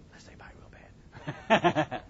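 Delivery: slow, breathy whispered punchline; whispered joke aside. Acted. Counselor jokes to parents about biting back, whispered. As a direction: whisper it like a naughty secret.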